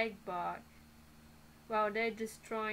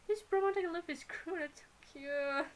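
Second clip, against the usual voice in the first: high, quick gush over something cute; 7 semitones higher than usual. Real, not acted. Reader gushing over how cute the fanboy is, gushing.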